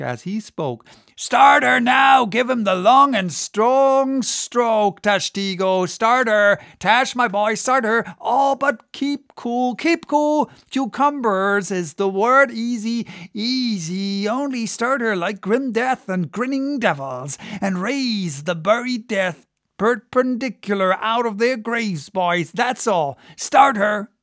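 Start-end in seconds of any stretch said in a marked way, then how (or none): 1.2-12.5 high, loud cheering-on; rousing whaleboat officer's shout; Stubb. A narrator's reading. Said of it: "Stubb cheered on his crew to the assault". 12.5-24.1 high-pitched urging, building to a call; raised-pitch whaleboat pep talk; Stubb. A narrator's reading. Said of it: "Stubb cheered on his crew to the assault".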